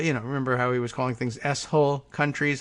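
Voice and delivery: ordinary talk, nothing marked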